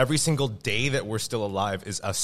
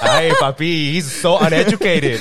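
zachwycona fanka latynoskiego akcentu; 6 semitones higher, 9 dB louder than usual. Acted. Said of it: "it's still sexy".

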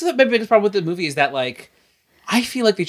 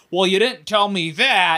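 slow, put-on dopey voice; hammed-up whiny hero; mock dumb hero; 3 semitones higher, slower than usual. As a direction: play him slow and clueless. Mocking Aquaman in recap.

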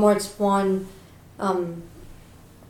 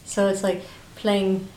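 ordinary talk, nothing marked

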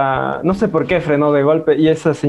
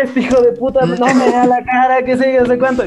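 higher mock yell at the thief; hammed-up fury, pitched up; retold outrage; 7 semitones higher than usual. Complaining about the thief to everyone, mock yelling. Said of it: "Y yo así como que".

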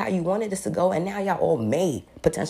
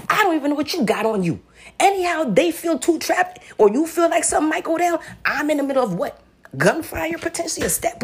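heated outburst; 11 semitones higher, 5 dB louder than usual. Real, not acted. Reliving fear of being trapped.

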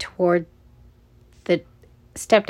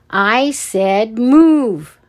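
louder, very slow, stretched-out yell; yelled command; 3 semitones higher, 6 dB louder, much slower than usual. A narrator's reading. Guard leans toward frozen scouts, loud drawn-out yell. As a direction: yell each word at them. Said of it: "leaned toward them and yelled".

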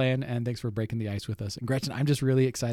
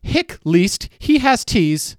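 loud slow sounding out; 8 semitones higher, 12 dB louder, much slower than usual. Real, not acted. Sounding out clue in a game.

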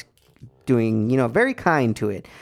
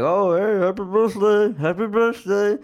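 higher, hammed-up awkward cheer; awkward mock greeting; 7 semitones higher than usual. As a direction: play it like a confused patient joining in.

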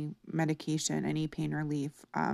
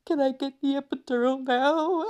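high, pleading plea for relief; desperate begging; 9 semitones higher, 5 dB louder than usual. Retold or imitated. In labour, begging the doctor for the epidural, desperate. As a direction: beg the doctor through the pain. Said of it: "like, crying and begging".

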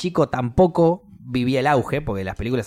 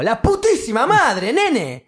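high-pitched, exasperated tirade; acted angry yell, pitched way up; furious outburst; 11 semitones higher, 3 dB louder, slower than usual. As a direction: swear at the kid in outrage. Acted rant at spoiling kid.

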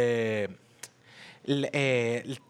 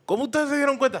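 high, startled question; mock surprised kid; 9 semitones higher, 3 dB louder than usual. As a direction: play the caught kid, astonished. Imita al chamaco sorprendido. (chamaco sorprendido, voz aguda)

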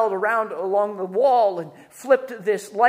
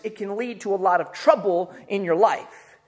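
ordinary talk, nothing marked

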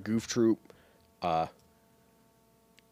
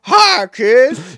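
much higher, louder creepy clown; 12 semitones higher, 12 dB louder than usual. Pretend. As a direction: greet kids like a sleazy clown.

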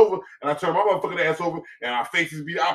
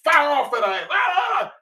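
ordinary talk, nothing marked

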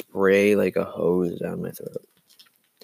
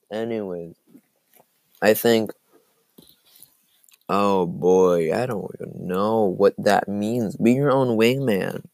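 ordinary talk, nothing marked

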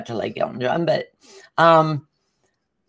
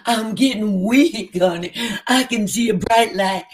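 hammed-up weak, fading voice, a little higher; faking faintness; 5 semitones higher than usual. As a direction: play a fake swoon for the guards. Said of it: "I started faking like I was gonna pass out".

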